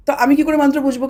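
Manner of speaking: usual speaking voice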